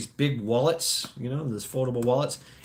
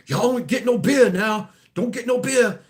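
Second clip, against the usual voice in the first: imitating the bus driver, higher and louder; bus driver warning; 10 semitones higher, 4 dB louder than usual. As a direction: play the old driver, pitched up, scolding. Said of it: "He's like".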